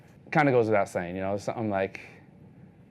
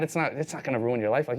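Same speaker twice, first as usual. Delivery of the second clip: usual speaking voice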